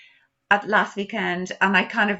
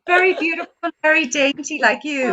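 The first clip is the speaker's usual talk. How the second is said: high gushing praise; cooing; 6 semitones higher, 5 dB louder than usual. Real, not acted. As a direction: coo it out fondly. Cooing over pregnant friend.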